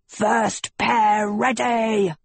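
slow, commanding call; booming. Acted. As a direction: boom it out like a herald. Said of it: "Castor boomed".

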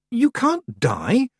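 high, slow, dismayed outburst; bursting out. A narrator's reading. Girl protesting to the old witch, bursting out. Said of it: "Tiffany burst out".

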